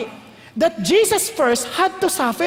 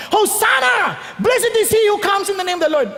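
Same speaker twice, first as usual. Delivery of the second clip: high, raised cry of acclamation; crowd shouting; 7 semitones higher than usual. Acted. Crowd hailing Jesus before the nervous Romans, shouting. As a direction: call it out like a cheering crowd. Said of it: "they were shouting".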